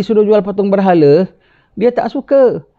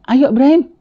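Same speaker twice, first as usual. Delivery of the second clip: ajakan kawan; 9 semitones higher than usual. Acted. Kawan mengajak ke pesta.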